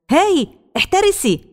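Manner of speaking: high, slow shouted warning from afar. A narrator's reading. A boy on a bike shouting a warning to Hana, high shouted alarm. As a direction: yell out to stop her in time. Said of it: "صوت يصرخ محذرًا".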